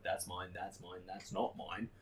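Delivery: soft, low sorting murmur; mock muttering. Walking the store picking out his stock, soft murmur. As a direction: murmur it while pointing things out.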